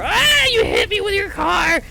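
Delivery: very high, drawn-out comic shriek; mock scream from under the wheels; shrill, rickety shouting. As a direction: shriek like an angry little chipmunk. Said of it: "hear shouting from beneath your tires".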